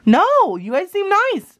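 high-pitched, insistent protest; emphatic reassurance. Acted. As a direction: insist eagerly, pitch jumping up. Moana insists they seem nice.

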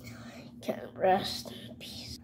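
eerie breathy whisper; ghostly whisper. Pretend. Ghost character.

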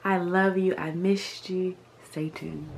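usual speaking voice

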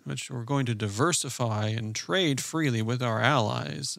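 slow, measured banker pitch. Acted. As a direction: spell it out slowly like a careful banker. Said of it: "this is my banker vote".

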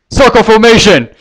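captain shouting a command; barked order. Acted. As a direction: yell the order to the troops. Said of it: "Crystal suddenly ordered".

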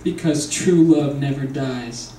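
hammed-up grieving vulture; dramatic vulture. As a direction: mourn like a tragic vulture, hammed up. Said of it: "Here's my impression of a very dramatic vulture".

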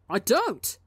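sharp, heated denial; hot demand. Acted. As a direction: snap it back hotly. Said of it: "Tommy demands hotly".

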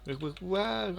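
ordinary talk

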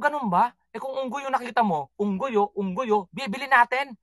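high, mocking sarcasm; husband sarcastically answering his wife; sarcastic retort.

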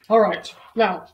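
loud, firm vet order; commanding vet. Acted. Vet telling George to bark again, firm command. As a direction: instruct the patient forcefully.

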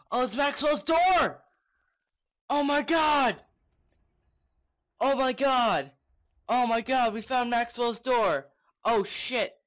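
high, loud thrilled yelling; excited shout. Real, not acted. Finds Maxwell's door.

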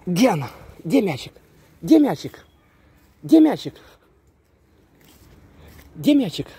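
high, rising excited teasing. Real, not acted. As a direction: get the dog worked up. Teasing dog with ball.

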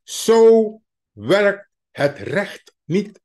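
each word spaced out, very slow; slow, deliberate. Shown as a demonstration. Correcting himself to his listeners, slow and emphatic.

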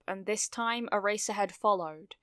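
usual speaking voice

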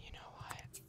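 unvoiced hushed aside; barely audible whisper. Real, not acted. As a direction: drop to a whisper mid-thought. Aside while sim dances.

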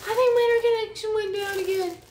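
high, loud, fast panic; real sudden dismay; startled alarm. Real, not acted. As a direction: blurt it as the connection drops. Sudden alarm at dropped connection.

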